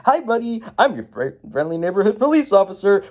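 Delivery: light, high friendly voice; sugary nice-cop greeting; a hint of an overly chummy officer; good cop. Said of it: "he's like".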